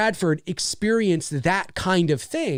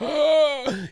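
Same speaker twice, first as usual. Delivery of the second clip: short, much higher gasp of shock; sharp gasp; 10 semitones higher than usual. Retold or imitated. Sam hears her own name on the phone, a sharp gasp. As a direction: gasp as if suddenly scared. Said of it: "the look on her face is just like".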